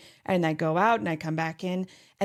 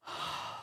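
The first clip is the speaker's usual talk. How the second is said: release sigh; 12 dB quieter than usual. Retold or imitated. Acting release of the pose.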